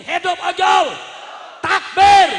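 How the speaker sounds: high, very loud rally cry; full-force cheer. Real, not acted. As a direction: roar it to set the crowd off. Leading other side in cheers.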